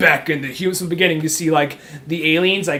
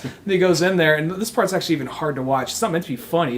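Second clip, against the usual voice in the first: ordinary delivery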